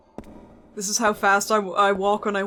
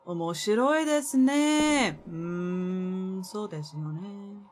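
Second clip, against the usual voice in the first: mock anime voice; 3 semitones lower, 4 dB quieter, slower than usual. Mock Japanese aside again.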